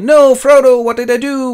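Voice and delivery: high, loud mock wail; Boromir. Boromir calls after vanished Frodo, high wail. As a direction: cry out in hammed-up regret.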